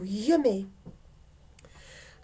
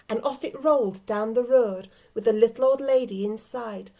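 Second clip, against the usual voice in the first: plain reading voice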